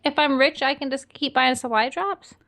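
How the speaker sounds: normal talking voice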